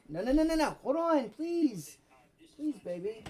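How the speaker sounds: high, urgent no's, slowing to a plea; frantic pleading to stop her falling; real panic. Real, not acted. Child about to fall.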